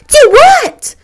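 very high, loud yelp of surprise; taken aback, pitch shooting up; startled. A narrator's reading. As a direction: jump at the question.

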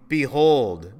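loud, ringing proclamation; loud voice from throne. Acted. A loud voice from the throne announces to all, proclaiming. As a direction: call it out like a herald. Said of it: "I heard a loud voice from the throne".

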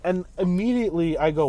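ordinary talk

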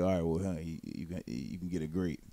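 quiet imitation of coworker. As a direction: mumble the offer like the awkward coworker. Imitating coworker offering grapes.

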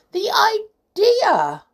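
high, loud, drawn-out indignation; indignant scoff. A narrator's reading. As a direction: exclaim at the very absurdity, slowly. Bear scoffs at carrying doll.

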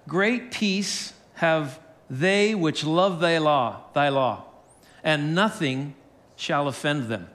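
slow, measured Bible reading; scripture reading. Acted. Pastor reading a psalm to the congregation, slow scripture reading. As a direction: read the verse slowly, with pauses between phrases. Reads a scripture verse aloud.

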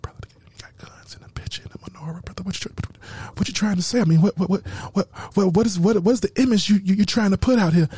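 low, stammering mock objector; hushed start into low, stuttering imitation; imitating nervous questioner. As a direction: mimic a worried doubter, tripping over words. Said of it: "had folks nervous".

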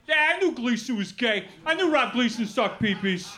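hammed-up Brooklyn accent. People from childhood claiming they knew, Brooklyn accent. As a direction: play a Brooklyn local in the know. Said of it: "do that joke in a Brooklyn accent".

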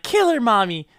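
put-on a small boy urging his mother on; little boy voice. Retelling the film, voicing the killer's son as a child.

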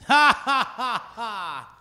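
loud, high spelled-out ha ha; fake laugh. Host laughing at his own weak line, fake ha ha. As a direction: laugh on purpose, mocking own joke.

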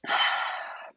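refreshed sigh after drinking. Real, not acted. Wow, I guess I really needed that water.

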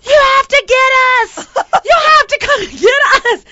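high, loud, desperate demand; retold screaming. Woman to the guy in the boat, desperate screaming. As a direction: yell across the water for rescue. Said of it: "I'm screaming at Heidi".

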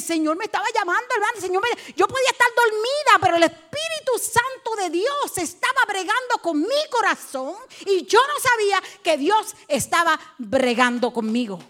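high, loud testimony; impassioned preaching. Real, not acted. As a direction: preach it out with rising fervor. Preaches at full force.

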